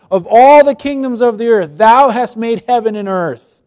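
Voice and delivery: solemn scripture read aloud. Acted. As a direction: read it out with reverence.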